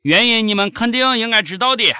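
ordinary delivery

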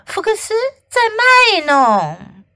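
high-pitched complaint; tattling schoolmate. A narrator's reading. Dexter complaining about Fox's showing off, tattling. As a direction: call out the show-off.

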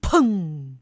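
low loud bang; door slam. Acted. Door slam sound effect.

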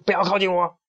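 high, severe keep-back cry; sharp warning. A narrator's reading. Hen guarding the treasure from everyone, sharp warning. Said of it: "小胖墩儿厉声警告大家".

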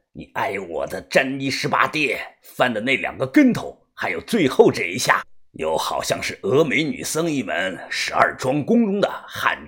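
very low, hushed villain voice; wary martial-arts master. A narrator's reading. Fighter probes opponent's lost techniques, low wary voice. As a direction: size up the opponent, low and soft.

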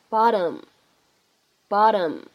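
"bottom" with a tapped tt, like "bah-dum"; American flap T. Shown as a demonstration. Teacher repeats American "bottom" with flapped tt. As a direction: flick the tt in "bottom" as a light d. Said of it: "它是一个闪音的处理".

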